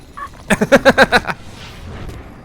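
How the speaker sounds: breathy high laughter. Real, not acted. Player laughing at failing to save it.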